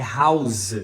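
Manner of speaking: "house" ending in a buzzing z; final Z sound. Shown as a demonstration. Teacher revealing the verb, "house" with final z. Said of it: "de um S para um Z".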